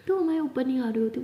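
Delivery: ordinary delivery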